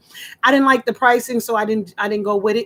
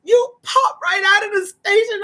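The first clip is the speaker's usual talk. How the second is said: a caller tearfully thanking the radio host; acting out crying caller; 8 semitones higher than usual. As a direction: sob the story out like the caller did. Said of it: "so I'm gonna act her out".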